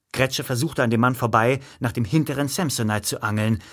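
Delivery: ordinary delivery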